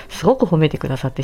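normal talking voice

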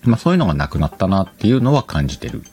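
normal talking voice

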